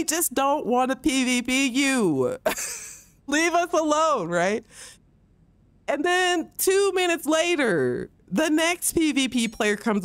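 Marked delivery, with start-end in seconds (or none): none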